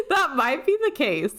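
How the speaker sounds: laughingly owning up; laughing concession. Real, not acted. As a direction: give in with a laugh. Laughing admission of getting old.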